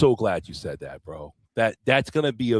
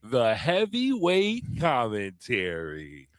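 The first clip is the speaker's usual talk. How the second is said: announcer; 3 dB quieter, slower than usual. Acted. Slow announcer finish of show name.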